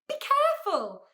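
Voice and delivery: high, loud alarm; shouted warning. A narrator's reading. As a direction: cry out a warning. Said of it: "shouted Milo".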